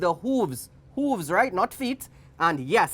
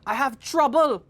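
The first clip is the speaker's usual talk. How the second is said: high, raised cry of distress; storyteller's alarmed cry; 8 semitones higher, 3 dB louder than usual. A narrator's reading. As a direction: call for help in a high voice. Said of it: "Titi cried".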